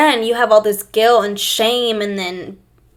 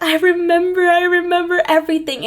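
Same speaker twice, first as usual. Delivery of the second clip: higher-pitched imitation of weeping words; retold weeping; 8 semitones higher than usual. Daughter weeping in her mom's arms, retold as a high cry. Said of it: "I was weeping, and I was in my mom's".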